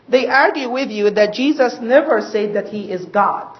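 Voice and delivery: usual speaking voice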